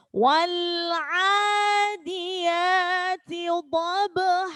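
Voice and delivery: slow chanted recitation; Quran recitation. Shown as a demonstration. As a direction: draw out the verse slowly. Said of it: "Quran recitation demo". (bacaan tartil perlahan)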